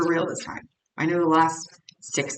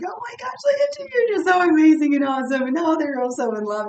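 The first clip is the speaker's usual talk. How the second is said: much higher, loud squealing gush; giddy gushing; 10 semitones higher, 8 dB louder, faster than usual. Retold or imitated. Reader imitating her own giddy reaction to good readings, high loud squeal. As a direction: gush like you're thrilled over a great reading. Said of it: "I get all giddy when I'm like".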